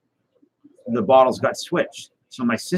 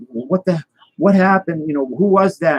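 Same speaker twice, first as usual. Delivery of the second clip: mock alarmed mother, pitched higher; mother's shocked question; 7 semitones higher than usual. Mother just knocked flying asks who hit her, alarmed higher voice. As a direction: voice the shaken mother, up high. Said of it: "and it's".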